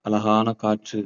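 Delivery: slow, soft meditation voice; guided meditation. Acted. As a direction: paint the calm scene slowly. Guided visualization in park.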